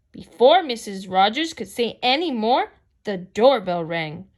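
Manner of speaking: ordinary delivery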